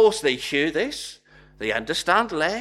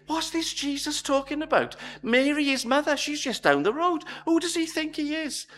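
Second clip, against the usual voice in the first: incredulous crowd; 8 semitones higher than usual. Retold or imitated. The crowd muttering to each other about Jesus, higher and incredulous. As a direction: mutter it to your neighbour, scandalised.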